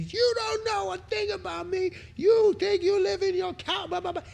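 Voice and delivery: imitating angry homeless man. Retelling a homeless man ranting at him, high mock rant.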